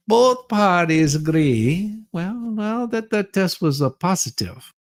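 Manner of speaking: usual speaking voice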